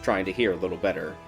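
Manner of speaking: usual speaking voice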